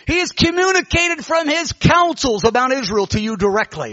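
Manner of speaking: high, emphatic prophetic declaration; authoritative prophecy. Retold or imitated. Woman prophesying to Bob Jones, high authoritative proclamation. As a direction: ring it out like a prophetess. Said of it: "she had a volume and an authority".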